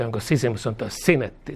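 normal talking voice

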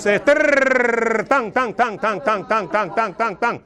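rolled trrrr then rhythmic tang chant; ecstatic trill and chant. Real, not acted. Preacher in ecstatic prayer, rolled trill and tang chant. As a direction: let the tongue rattle, then pulse.

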